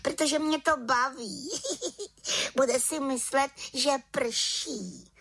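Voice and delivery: very high witchy voice with a giggle; giggling little witch. A narrator's reading. Little witch delighted by her prank, giggling. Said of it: "Čarodějnice se pochechtávala".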